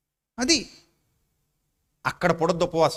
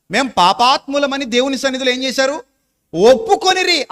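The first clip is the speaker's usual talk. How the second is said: climbing higher and louder to a ringing shout on ఒప్పుకొనిరి; rising preached climax; 4 semitones higher, 6 dB louder than usual. Acted. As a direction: drive the last word home at the top of the voice.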